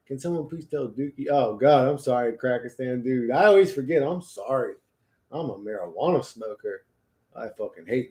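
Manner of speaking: usual speaking voice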